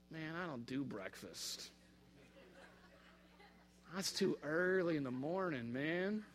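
lazy pothead shrugging it off; lazy stoner. Retold or imitated. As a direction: stoner chef slowly refusing breakfast duty. Lazy pothead chef refusing.

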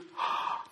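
breathy unvoiced gasp of shock; light mock gasp; soft gasp.

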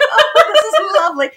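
mock posh British. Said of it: "It makes me feel so formal".

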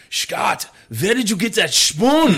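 high, loud hammed-up German accent; [name]'s German accent. Soldier asking his American partner about cookware, hammed-up German accent. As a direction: imitate an excited German soldier, thick accent. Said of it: "he was German".

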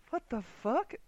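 mock disbelief. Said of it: "It's like".

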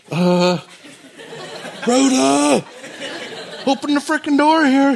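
high, loud, half-laughing complaint; exasperated Peter. Retold or imitated. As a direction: plead at the locked gate, fed up. Peter voiced, locked out.

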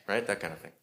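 ordinary delivery

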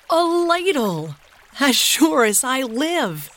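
high, slow, breathless wonder; amazed gasp. A narrator's reading. Henry amazed at the homemade ladle, gasping high and slow. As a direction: gasp it out like finding treasure. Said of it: "gasped Henry".

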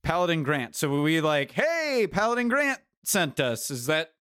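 ordinary talk

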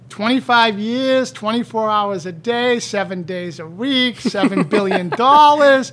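slow, deliberate, each figure weighed; pointed listing. Retold or imitated. Slowly listing the doctors' claims back at them, pointed. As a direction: lay out each number like an accusation.

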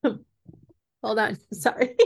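coughing and laughing it off; choking. Real, not acted. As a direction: clear the throat between words.

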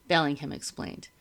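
ordinary delivery

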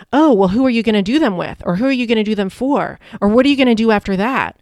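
mimicking nosy barista. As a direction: pile on the questions fast, imitating an over-eager barista.

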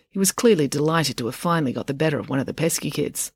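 ordinary delivery

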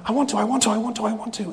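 put-on child begging dad to mow; pleading child. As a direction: beg like a little boy.

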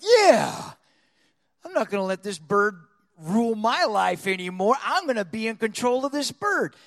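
loud, fired-up resolve; bold aggressiveness. Retold or imitated. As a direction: burst out with a defiant yeah, then declare it. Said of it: "I just had this bold aggressiveness".

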